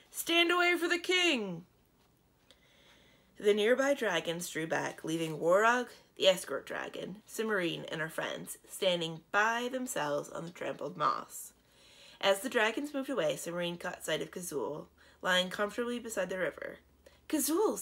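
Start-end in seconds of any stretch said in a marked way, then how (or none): 0.1-1.6 high, loud announcement; shouting. Acted. Dragon in the river clearing space for the king, high shout. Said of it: "shouted someone in the river". 17.3-17.8 high, louder cry of a name; cried out. Acted. Princess Cimmerine spotting her dragon king, glad cry. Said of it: "Cimmerine cried".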